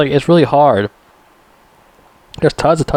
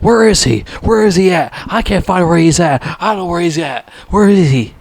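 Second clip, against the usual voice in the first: mock-frantic, high-pitched searching; Brian Denny, frantic; 6 semitones higher than usual. Brian Denny unable to find Rambo, mock frantic. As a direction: imitate someone rattled, repeating himself in a higher voice. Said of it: "Brian Denny's character still can't find him".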